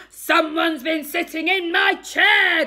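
huge growling shout; growling Daddy Bear. A narrator's reading. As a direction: bellow like an outraged father bear. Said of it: "growled Daddy Bear".

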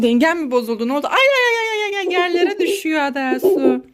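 normal talking voice

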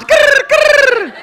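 high, loud chirping imitation; imitating crickets. Mocking cricket as silence, high loud cricket chirp. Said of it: "That is the sound of fuck all".

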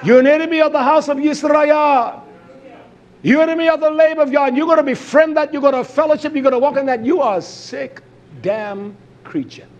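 full-force sermon delivery; fiery preaching. Acted. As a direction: preach it hard at the congregation.

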